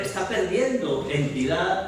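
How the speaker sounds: normal talking voice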